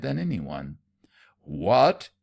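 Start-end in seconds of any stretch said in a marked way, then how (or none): 1.4-2.1 a loud, stunned shout. A narrator's reading. Colonel shouting at Leonard in outrage, loud. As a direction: bellow the word in outrage. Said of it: "shouted Colonel Hampton".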